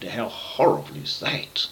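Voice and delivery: put-on hushed aside to the children; breathy whispered aside. A narrator's reading. Storyteller whispers an aside to the listening children.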